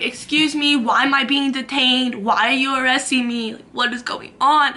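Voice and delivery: loud acted indignation; indignant protest. Protesting to cops arresting her, loud indignation. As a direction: demand answers, loudly.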